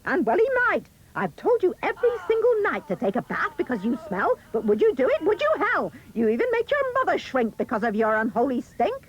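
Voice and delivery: high, loud, swooping scold; nagging mother. A narrator's reading. Mother telling smelly Jack to bathe, nagging scold. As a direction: nag him with mounting exasperation. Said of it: "The mother said".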